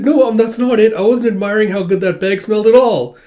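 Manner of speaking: high, loud over-protesting; flustered denial. Acted. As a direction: deny it too hard, caught out. Caught eyeing food, denies it.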